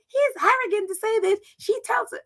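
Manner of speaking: mocking critics. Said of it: "And that they're like".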